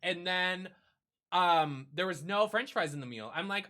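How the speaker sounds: usual speaking voice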